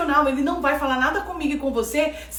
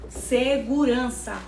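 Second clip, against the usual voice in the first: slow, lower, drawn-out "Segurança"; calm reassurance; 3 semitones lower, much slower than usual. Shown as a demonstration. Preacher telling listeners God's voice brings safety, calm reassurance. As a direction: let the word settle slowly and steadily.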